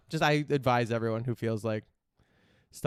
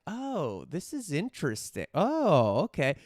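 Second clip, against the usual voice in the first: mildly surprised, higher-pitched "oh" of discovery; intrigued reaction; 5 semitones higher, slower than usual. Retold or imitated. Watching a detox video and getting hooked, intrigued. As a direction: act out growing interest, voice lifting.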